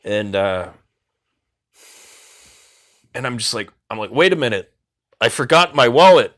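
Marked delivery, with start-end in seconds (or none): 4.1-6.3 loud excuse. Retold or imitated. Excuse to escape a robbery.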